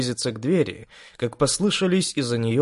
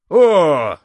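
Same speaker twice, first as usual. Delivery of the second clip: surprised; 5 semitones higher, 9 dB louder than usual. Acted. Surprised exclamation by the big man.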